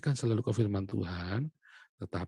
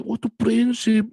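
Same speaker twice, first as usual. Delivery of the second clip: put-on lecturing older sibling; 9 semitones higher, 4 dB louder than usual. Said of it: "Kakak saya ngaji".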